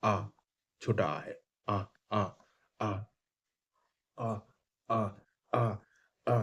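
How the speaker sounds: आ sounded again and again, held; vowel demo. Shown as a demonstration. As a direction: drill आ slowly for students. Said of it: "जैसे आप बोलिए". (आ को बार-बार खींचकर बोलें)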